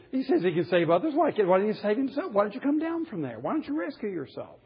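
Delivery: high, laughing scornful jeer; mocking taunt. Passersby mocking Jesus on cross.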